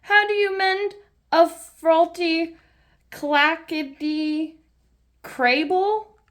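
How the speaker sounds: put-on high little girl voice saying "clackety crable", slow on "clackety"; childish mispronunciation. Peppa garbling "faulty clackety crable" when asking Mister Bull. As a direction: stumble over the big words like a small child.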